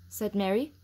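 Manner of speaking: plain reading voice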